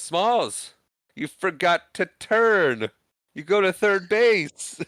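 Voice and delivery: high, loud, drawn-out holler; Patrick Renna delivery. Retold or imitated. Teammate yelling to batter at the fence, slow holler. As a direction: call it across a field, stretching each word. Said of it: "another great delivery from Patrick Renna".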